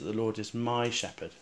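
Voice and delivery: "my" standing out from the phrase; stress on my. Shown as a demonstration. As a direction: press on "my". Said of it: "to emphasize that".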